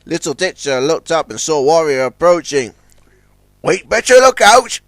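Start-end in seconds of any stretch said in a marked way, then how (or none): 3.6-4.8 warning. A narrator's reading. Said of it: "he warned".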